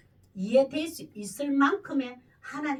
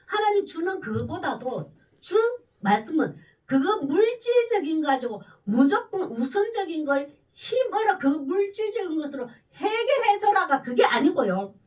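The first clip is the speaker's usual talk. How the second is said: ordinary talk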